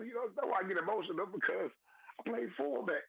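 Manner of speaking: high, tearful voice; choking up while explaining; fighting tears, pitch climbing; real crying. Real, not acted. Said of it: "I'm sorry to be crying online".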